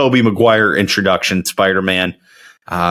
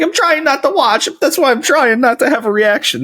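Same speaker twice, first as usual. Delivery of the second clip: put-on high squeaky girly voice; imitating roommate. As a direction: mimic her defensive whine. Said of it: "She's like".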